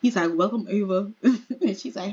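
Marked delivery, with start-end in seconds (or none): 0.3-1.1 lightly lowered pitch for a man's greeting; a deeper character voice, playfully put on. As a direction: drop into a mock deep voice. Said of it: "he just looked like he has a deep voice".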